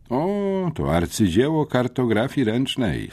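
high-pitched sarcastic praise; mocking. A narrator's reading. Syfon makes fun of the interrogation, openly mocking. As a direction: gush in mock admiration, starting very high. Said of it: "próbował wyraźnie kpić z sytuacji".

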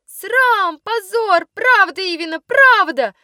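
high indignant outcry; chorus of outrage. Acted. Girls taking up Ivina's cry, high indignant outcry. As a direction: shout shame and disgrace in a high voice. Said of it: "подхватили в один голос все девочки".

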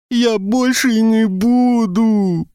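high, slow, tearful howling plea; whiny childish wail. Acted. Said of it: "провыл с плаксивыми детскими интонациями".

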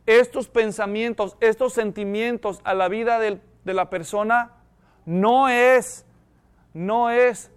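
normal talking voice

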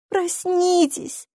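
put-on high, drawn-out child's plea; beg, stretching the word slowly; pleading. A narrator's reading. Girl begs her parents to wake, pleading. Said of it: "взмолилась Сьюзи".

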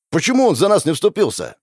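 high, outraged demand; explosive anger. A narrator's reading. Hero asks why nobody defended them, outraged. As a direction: demand it in indignation. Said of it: "взорвался я".

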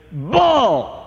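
a lightly voiced woman heckling from the crowd; heckling woman's call. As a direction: call it out from the audience, mock-scornful. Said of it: "A female voice saying that".

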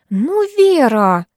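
whiny, drawn-out plea; slow whining nag; капризно. A narrator's reading. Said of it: "закапризничала Ирка". (капризно и протяжно)

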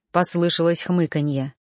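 usual speaking voice

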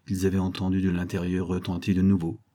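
ordinary delivery

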